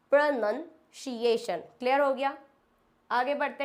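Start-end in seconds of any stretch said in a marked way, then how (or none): 0.1-1.6 pronunciation as "pro-nun-see-ay-shun", very slowly; very slow correct pronunciation. Shown as a demonstration.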